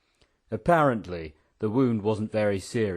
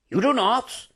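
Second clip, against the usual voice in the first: high, forceful refusal; emphatic; 13 semitones higher than usual. A narrator's reading. General refusing the Prince his cooperation, emphatic. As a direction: refuse point-blank, pitch leaping up. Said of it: "said the General emphatically".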